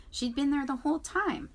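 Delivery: ordinary delivery, nothing marked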